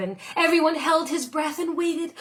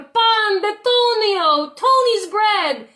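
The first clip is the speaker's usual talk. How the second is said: high, loud, slow chant; crowd calling; 8 semitones higher, 6 dB louder, slower than usual. A narrator's reading. Crowds outside calling for Tony's bread. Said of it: "calling for".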